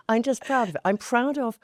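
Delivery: laughing through the words. Real, not acted. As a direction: giggle while saying it. Laughs at own pride.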